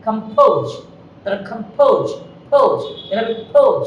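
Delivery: কম্পোজ with an aspirated p, like ফোজ. Shown as a demonstration. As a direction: puff of air on পোজ in কম্পোজ. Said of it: "ওখানে aspirated হবে". (কম্পোজ, প-কে ফ-এর মতো হাওয়া দিয়ে)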